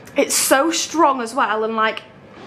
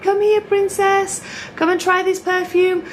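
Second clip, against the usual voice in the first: stallholder's coaxing; 7 semitones higher than usual. Retold or imitated. Perfume sellers luring a passing girl, coaxing call. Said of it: "every single stand as I was walking around was like".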